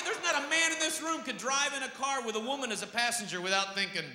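normal talking voice

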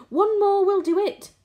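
high, loud, eager call; shout. A narrator's reading. Old green grasshopper from the tunnel, shouting. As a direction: shout encouragement well up in pitch. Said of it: "shouted the old green grasshopper".

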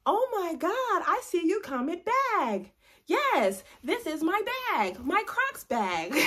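put-on high, loud kids' show host; hammed-up, high-pitched excitement, slowing at the end; Dora Explorer. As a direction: call out to viewers like an excited cartoon explorer. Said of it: "I'm finna act like Dora Explorer".

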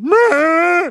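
loud, very high animal cry; imitated deer bleat. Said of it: "It fucking went".

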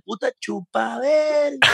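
retold tic yell. Retelling his tic at the Argentine woman, slow mock yell. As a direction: stretch the words out like a half-hearted retold shout. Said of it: "le grito".

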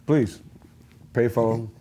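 ordinary talk

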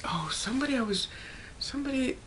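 trailing off into a whisper; soft, slowing whisper while remembering; fading to a whisper. Real, not acted. She whispers to herself trying to recall who it was.

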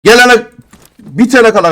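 loud, high burst of resolve; defiant shout. Real, not acted. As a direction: shout it like a vow. Vowing to keep opening crates. (inatla bağırma)

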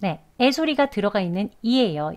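normal talking voice

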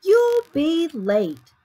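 high, loud, drawn-out call. Acted. Mother warns Sammy he will be late, calling out. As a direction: call up to a dawdling child.

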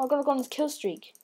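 ordinary delivery

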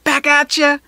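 high, loud playful yell; playful shout. A narrator's reading. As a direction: fling it back with a shout. Kids throwing paper planes.

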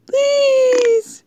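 very high whining please; high-pitched desperate whine; squeaky whine. Real, not acted. Begging friend to stop.

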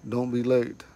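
ordinary talk, nothing marked

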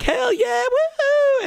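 very high, loud whooping cheer; wild celebration. Retold or imitated. Streamer dancing after the gold star, shrieking cheer. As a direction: scream it like you just won the jackpot. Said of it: "I get up, I'm doing a dance".

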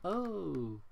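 ordinary talk, nothing marked

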